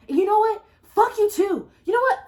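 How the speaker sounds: high, loud angry outburst; furious yell. Retold or imitated. Friend on the phone screaming at the boyfriend, furious yell. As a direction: blow up at him.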